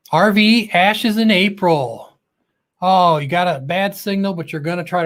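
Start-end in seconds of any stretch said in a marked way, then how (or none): 0.1-2.1 slow, louder and higher name shout-out; drawn-out welcome call; announcer call-out. Acted. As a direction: announce the channel like a host. Host calls out viewer's channel name.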